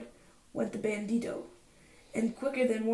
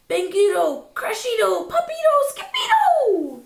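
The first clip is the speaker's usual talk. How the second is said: loud; 11 semitones higher, 10 dB louder than usual. A narrator's reading. Explosion sounds from closet.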